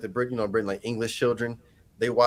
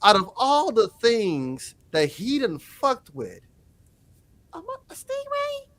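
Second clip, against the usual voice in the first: high-pitched disbelief, slowing as it goes; incredulous; 8 semitones higher than usual. Retold or imitated. Reacting to stingray taking my boy out, incredulous. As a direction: end in a tiny, very high questioning squeak. Retelling own disbelief at the death.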